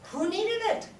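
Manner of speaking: normal talking voice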